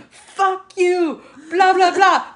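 high, laughing mock fury at an enemy; hammed-up British-free rage, cracking up; mock angry outburst. Acting out an angry pinch fight.

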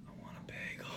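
barely audible, hushed whisper; whispered craving. Retold or imitated. Retelling the second viewing, same whispered craving. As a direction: whisper the wish under your breath.

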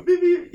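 high-pitched beep beep of a horn; imitated car horn. Driver honking at the car beside him, imitated horn. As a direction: honk like a car, high and quick. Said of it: "I'm hitting the horn on him".

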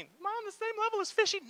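hurt wife. Retold or imitated. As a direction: squeak it out like a wounded wife. Wife questions husband's love.

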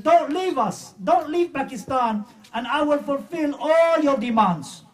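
a hammed-up begging cry; mock pleading. Preacher retelling Qadri begging at the Prophet's feet, mock wailing plea. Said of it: "cries, screams, beg him".

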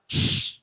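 loud, sharp shushing rush; loud "shh" sound effect; imitated hiss of air. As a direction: make the noise of escaping air. Imitates tube going into lung.

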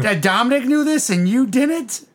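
high, drawn-out mock "ooh"; mock disdain. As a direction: rub it in with slow, high-pitched teasing. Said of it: "the sister's kinda like, "Ugh".

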